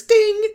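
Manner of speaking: squeaky high ding; mock bell chime. Sound effect called out.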